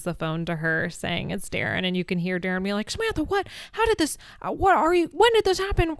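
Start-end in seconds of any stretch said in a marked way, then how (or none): 2.9-6.0 high, stumbling mock panic; imitated freaking out. Darren on the phone to Samantha, imitated freak-out. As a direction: act flustered, questions tumbling out high. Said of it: "And he's freaking out".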